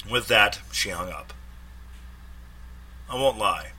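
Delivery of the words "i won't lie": ordinary delivery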